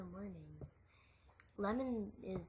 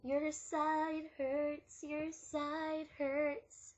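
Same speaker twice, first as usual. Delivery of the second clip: very slow, sing-song mockery; slow mocking; 3 semitones higher, much slower than usual. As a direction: drag it out to mock. Mocking drawn-out repeat.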